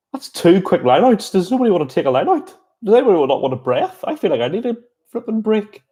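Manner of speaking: incredulous, half-laughing plea for a breather; amused exasperation. Real, not acted. Commentator overwhelmed by a quick game, amused exasperation.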